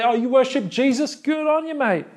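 high, breezy Australian Roman voice; mock Australian. Roman citizen shrugging off Christians, mock Australian accent. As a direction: play a Roman dismissing it in Australian. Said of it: "I'm sure they spoke in Australian".

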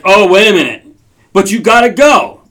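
sudden loud alarm at the choice; loud flustered panic; panicked realization. Retold or imitated. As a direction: blurt it out, caught off guard. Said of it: "Now you're like".

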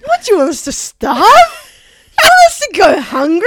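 high, loud pleading complaint; hammed-up outraged question; mock indignation. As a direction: protest like a wronged, starving diner. Joins act as starving customer.

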